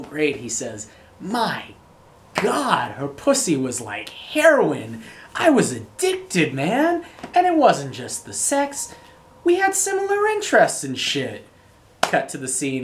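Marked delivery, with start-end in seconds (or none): 1.2-11.5 much higher, louder, hammed-up Leo; quoting Leo. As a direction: crank the pitch way up, a giddy excess. Quoting Leo's line in movie.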